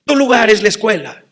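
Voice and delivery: loud, high stern lecture, hammed-up; strict father. Father scolding his son to stay in school, loud and stern. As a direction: bark it like a strict dad. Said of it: "El padre estricto".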